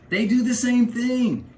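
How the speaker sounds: high, slow, plummy English impression; mock English accent. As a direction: send up a toff from England. Mocking English way of talking.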